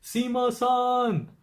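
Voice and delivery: high, drawn-out holler; imitated yell. Imitating a friend calling store workers, long high yell. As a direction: stretch it out as a high call for help. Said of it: "just yelled at the top of his lungs".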